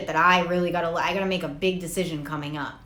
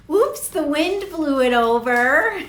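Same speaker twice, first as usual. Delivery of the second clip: usual speaking voice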